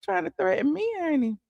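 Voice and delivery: dismissive. Retold or imitated. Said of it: "I'm like".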